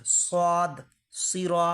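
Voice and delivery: dark, full-mouthed s in সদ and সিরা; correct heavy sad. Shown as a demonstration. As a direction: say সিরা as "seeraw" with emphatic s. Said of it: "এখানে সদ আছে".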